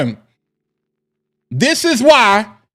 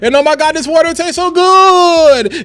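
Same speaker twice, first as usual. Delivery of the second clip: high, louder gushing with stretched endings; mocked influencer inflection; 7 semitones higher, 4 dB louder than usual. Creator mimicking people showing free mail, high exaggerated inflection. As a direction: send up a breathless product unboxer. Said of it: "that inflection at the end of the voice".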